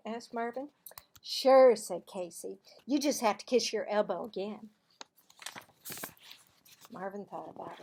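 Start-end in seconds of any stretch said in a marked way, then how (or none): 1.2-1.8 loud, bright schoolgirl telling a secret; Cassie's voice. Acted. Girl telling Marvin a playground rumour, loud and eager. 2.9-4.7 loud, bright schoolgirl telling a secret; Cassie's voice. Acted. Girl telling Marvin a playground rumour, loud and eager.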